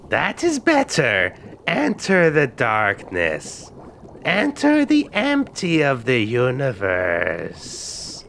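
slow, drawn-out mystic chant; mystic witch. Acted. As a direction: intone it slowly like a wise hag. Witch guiding meditation.

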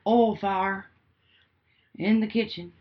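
slow Southern drawl, "oil" said as "ol"; hammed-up Southern call about a kitchen fire. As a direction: drawl it slowly, mock emergency. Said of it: "that's what I would say".

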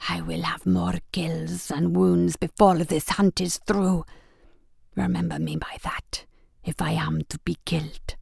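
a dying warrior's grim whisper; low grim whisper. Acted. Wounded Scar Eye's grim vow.

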